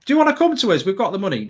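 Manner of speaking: high-pitched wheedling question, easing down after; mock sales pitch. United wooing a player they want, mock sales pitch.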